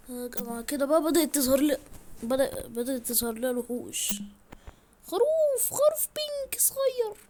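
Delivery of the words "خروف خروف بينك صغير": high, slow breathless whisper; delighted squeal. Real, not acted. Gamer spotting a little pink sheep, squealing with delight. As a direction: coo over the cute find.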